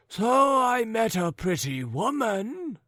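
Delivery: put-on high, wavering old man's voice; chatty old man. Forgetful old man telling his neighbours of a woman, high and doddering.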